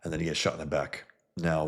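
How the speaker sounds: usual speaking voice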